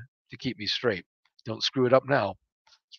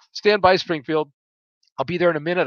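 raised, higher-pitched reply; tone rising to match; 4 semitones higher, 5 dB louder than usual. A narrator's reading. Pilot answering impatient flight leader over radio, tone rising. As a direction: answer back firmly, louder and higher. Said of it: "my tone rising to match his".

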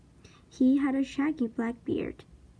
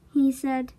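ordinary delivery, nothing marked

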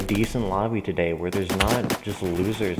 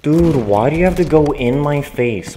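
louder, higher exasperated yell; angry yell; 4 semitones higher, 9 dB louder than usual. Real, not acted. Gamer yelling at the player who rushed him, angry. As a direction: complain loudly to the opponent. Killed again in game.

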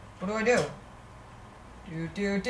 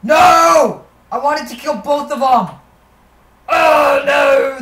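frustrated shout; 6 semitones higher, 9 dB louder, slower than usual. Real, not acted. Missed a target in game.

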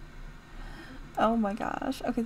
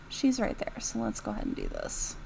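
normal talking voice

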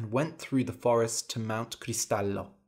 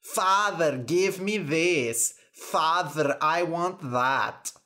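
raised, slow mock-demanding child; mimicked tantrum; 4 semitones higher, slower than usual. Fairy mimicking the daughter's tantrums, mock whiny demands. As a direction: imitate a spoilt girl's demands. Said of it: "her screams every time she throws a tantrum".

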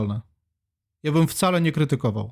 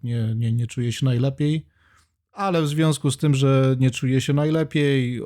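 ordinary talk

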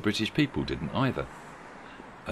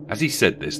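usual speaking voice

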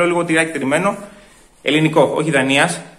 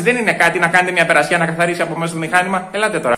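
ordinary talk, nothing marked

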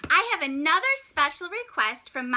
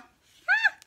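high startled shriek; scream. Acted. Penguin spotting a leopard seal, high startled shriek. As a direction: shriek in fright.

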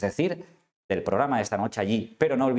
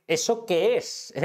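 pitched up and slowed, like someone who can't believe it; indignant outburst; 7 semitones higher, much slower than usual. Pretend. Host voicing what someone should tell the squatter, in mock outrage. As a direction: fire it off in disbelief. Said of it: "le tiene que decir".

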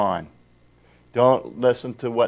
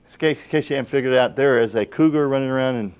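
normal talking voice

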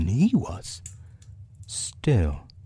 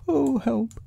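very high bear voice; high storybook bear in trouble; Pooh. A narrator's reading. Pooh stuck in the hole, high fretful voice.

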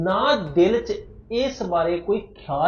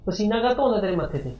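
higher, fast probing question; suspicious demand; 5 semitones higher, faster than usual. Acted. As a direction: pounce on her nervousness, quickly. Husband interrogates scared wife. (ਸ਼ੱਕ ਨਾਲ ਤੇਜ਼ ਉੱਚਾ ਸਵਾਲ)

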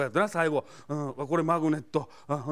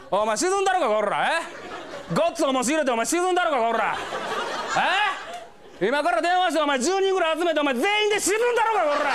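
yankee yelling; 11 semitones higher than usual. Retold or imitated. Delinquent threatening the clerk, furious shout. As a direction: bellow it like a furious thug. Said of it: "笑い我慢するのは大変".

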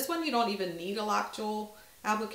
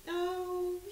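pleased surprise; 11 semitones higher, 3 dB quieter than usual. Real, not acted.

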